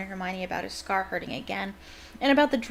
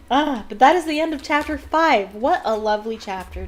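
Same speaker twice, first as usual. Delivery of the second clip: cheery announcement; 4 semitones higher, 7 dB louder than usual. Real, not acted. Reader ends chapter.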